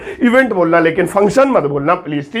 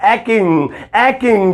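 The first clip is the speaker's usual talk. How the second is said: slow correct word; much slower than usual. Shown as a demonstration. Teacher introducing the correct word aching, slow and clear. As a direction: stretch out aching slowly and clearly. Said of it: "सही word".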